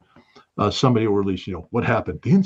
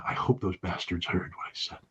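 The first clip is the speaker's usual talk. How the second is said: whispered aside; 3 semitones lower, 11 dB quieter than usual. Retold or imitated. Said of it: "at a cultural event going".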